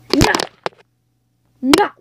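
ordinary delivery

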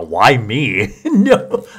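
laughing out loud. Real, not acted. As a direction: burst out laughing. Laughing at the teasing.

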